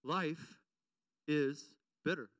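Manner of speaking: life and bitter said slowly with pauses; preacher driving the point home; slow emphatic repeat. Shown as a demonstration. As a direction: lean on bitter, slow and low. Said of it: "I'm gonna say this again".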